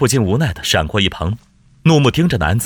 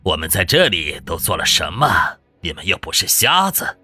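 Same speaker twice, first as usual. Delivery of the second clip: scornful villain, pitched a little down; cold sneer; 3 semitones lower than usual. A narrator's reading. Hostage-taker scoffs at his fellow disciples, sneering. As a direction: sneer at them in a put-on villain voice. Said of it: "男子冷笑一声道".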